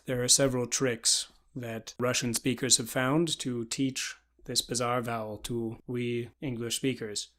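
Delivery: usual speaking voice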